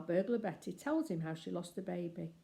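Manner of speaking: ordinary delivery, nothing marked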